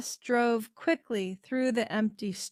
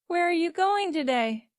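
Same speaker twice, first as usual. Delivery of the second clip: mother's voice; 9 semitones higher, 6 dB louder than usual. A narrator's reading. Mother asking over tea.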